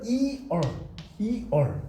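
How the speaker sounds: "이얼" as two distinct syllables, 이 then 얼; two separate vowels. Shown as a demonstration. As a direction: sound out "이얼" slowly with 이 held apart from 얼. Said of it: "떨어져서 발음이 된단 말이에요". ("이얼"을 이와 얼로 떨어뜨려 발음)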